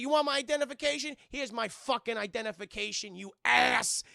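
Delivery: high start, loud insult at the end; acted indignation, punching the last word; angry retort. Streamer as Dick Miller answering the robot, angry retort.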